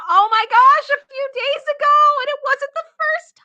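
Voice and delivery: high, loud shocked exclamation; scandalized disbelief. Real, not acted. Streamer shocked by story.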